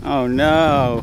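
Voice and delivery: mock alarm. Mock disaster after the take.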